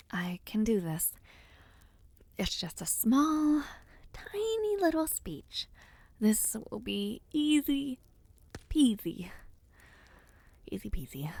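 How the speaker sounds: whispered pep talk to herself, wavering; nervous whisper. Acted. Alone backstage, steadying herself before her speech, nervous whisper. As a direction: breathe the words to calm your nerves.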